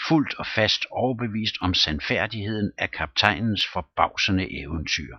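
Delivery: ordinary delivery, nothing marked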